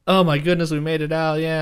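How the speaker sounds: high, loud relief, easing off; relieved exclamation. Retold or imitated. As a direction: exclaim as if just escaped. Acting out the group's relieved escape.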